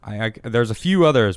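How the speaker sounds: ordinary talk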